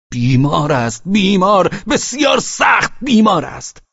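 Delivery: loud, high furious shouts; shouting. A narrator's reading. Velchaninov yells at Pavel about his dying daughter. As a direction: hurl each phrase at him.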